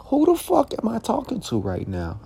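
the same "Who the fuck am I talking to right now?" still high but dropped in volume. Retold or imitated. Repeats outraged question, softer.

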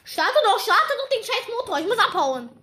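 loud, urgent yelling at the car; frustrated shouting. Real, not acted. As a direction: yell it, panicking.

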